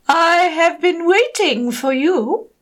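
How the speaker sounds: deep octopus voice. Acted. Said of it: "said the octopus with a deep voice".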